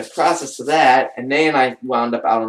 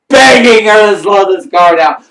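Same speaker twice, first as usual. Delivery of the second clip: dramatized begging; 7 semitones higher, 13 dB louder than usual. Retold or imitated. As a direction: belt it out like a desperate plea. Reliving begging friend to slow down.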